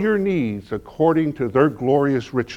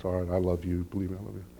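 soft, hushed I love you; tender reassurance; 9 semitones lower, 13 dB quieter, much faster than usual. Retold or imitated. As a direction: comfort her gently while holding her. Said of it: "And I hold her".

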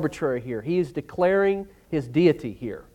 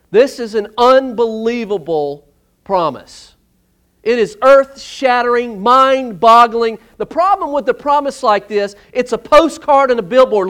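loud, raised preaching with slowed emphasis; fervent preaching; 5 semitones higher, 7 dB louder than usual. Acted. Pastor stirring the congregation about the promise, loud and fervent. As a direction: build the wonder of the promise.

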